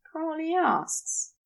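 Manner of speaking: ordinary delivery, nothing marked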